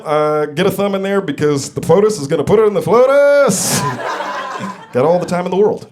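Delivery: lightly voiced Obama with halting uhs; a hint of Obama; Obama impression. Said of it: "Obama. He'd be like".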